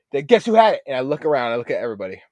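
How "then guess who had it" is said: higher, louder gleeful tease; teasing reveal. Retold or imitated. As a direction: dangle the reveal. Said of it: "I'm like".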